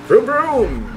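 high playful vroom vroom; engine noise. Pretend. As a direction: imitate a revving engine.

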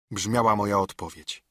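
ordinary delivery

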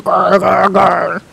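a low, lightly done zombie growl; walker growl. Retold or imitated. Mocking walkers to the hiding girl, low growl. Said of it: "all they say is".

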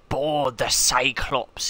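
put-on slow, drawn-out Scottish accent. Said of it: "speak in a Scottish accent".

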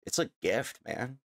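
ordinary talk

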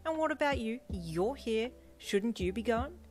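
snarky. A narrator's reading. The fan girl snaps back at you, snarky. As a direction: fire it back with sass. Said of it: "she shot back in a snarky tone".

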